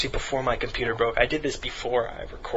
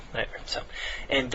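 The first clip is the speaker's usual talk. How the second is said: normal talking voice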